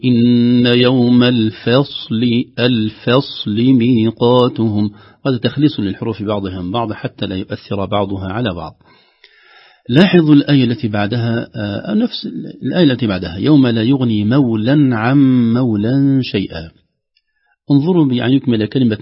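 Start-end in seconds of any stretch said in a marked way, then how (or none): 0.1-4.9 slow, sustained tajweed chant; Quran recitation. Shown as a demonstration. Teacher demonstrating a verse to students, slow tajweed recitation. 13.4-16.7 slow tajweed recitation; Quran recitation. Shown as a demonstration. Teacher reciting the next verse to students, slow tajweed.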